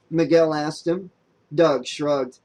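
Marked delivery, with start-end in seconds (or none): none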